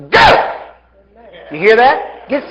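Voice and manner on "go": explosive, high, loud order; full-force yell; shouted command. Shown as a demonstration. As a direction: blast it out to drive the sickness away. Demonstrates commanding emphysema out.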